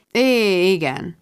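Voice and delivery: hesitant, drawn-out yes that sinks away; doubtful. A narrator's reading. Mark answers Rebecca's leading question, doubtfully. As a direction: agree reluctantly, with doubt. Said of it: "mondta kétkedve".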